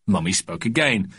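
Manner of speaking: ordinary delivery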